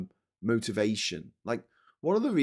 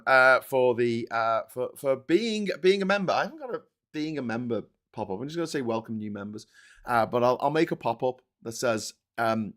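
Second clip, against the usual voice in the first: ordinary talk, nothing marked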